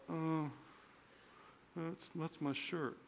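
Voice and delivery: very soft, slow, embarrassed mumble; sheepish mumble. Retold or imitated. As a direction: barely get the words out. Sheepish answer to angry father.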